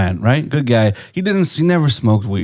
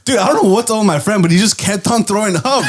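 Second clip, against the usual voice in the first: normal talking voice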